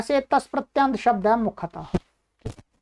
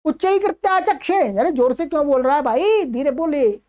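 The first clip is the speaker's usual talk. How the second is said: scolding father; 2 semitones higher than usual. Acted. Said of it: "तो बोला".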